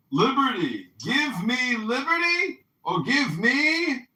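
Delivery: loud, high, drawn-out rallying shout; over-the-top liberty cry, stretched out; mock patriotic cry. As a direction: holler it like a hammed-up revolutionary speech. Mock patriotic oration, joking.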